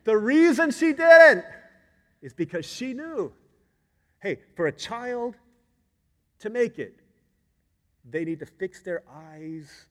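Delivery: loud, high, deliberate phrases with pauses; emphatic preaching. Acted. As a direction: proclaim the point, then ease down. Preaching at full force.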